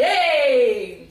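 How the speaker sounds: loud "Hey!"; cheerful loud call; group call. Shown as a demonstration. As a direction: shout it to signal a new game. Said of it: "ça veut dire qu'il va y avoir un nouveau jeu".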